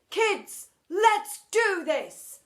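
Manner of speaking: high, loud, slow proclamation; proclaimed. Acted. Teacher rallying kids, grand proclamation. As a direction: declare it like a battle cry. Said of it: "proclaimed Mister Phoebe".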